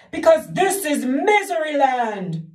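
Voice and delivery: high, loud, slow weeping; weeping king. Acted. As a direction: weep it like a miserable king. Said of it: "wept the king".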